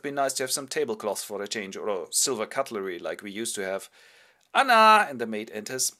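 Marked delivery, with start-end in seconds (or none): 4.5-5.1 loud high call across the house. Acted. Biedermann calling for maid Anna, loud.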